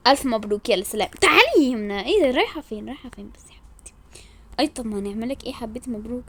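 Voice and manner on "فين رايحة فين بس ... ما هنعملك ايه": hushed, breathy scheming aside; whispered aside. Acted. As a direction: whisper it so no one hears the plan.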